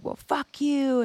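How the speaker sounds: high, slow hostile comeback; slow mock retort. Other driver answering back, slow high spiteful retort. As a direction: drag it out to spite him.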